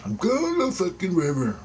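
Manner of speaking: exasperated man mock-yelling at himself. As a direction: snap back at your own nagging thoughts, pitched high. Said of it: "I'm yelling at myself".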